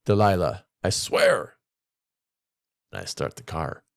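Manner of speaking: reading a short story aloud to the class; an expressive read-aloud, lightly voicing the dialogue. A narrator's reading. Teacher reads a story to class, lively read-aloud.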